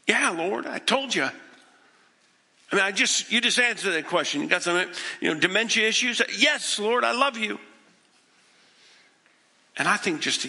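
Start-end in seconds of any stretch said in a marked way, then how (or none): 0.1-7.6 exasperated Peter. Acted. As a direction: play an impatient, incredulous disciple. Exasperated Peter answering Jesus again.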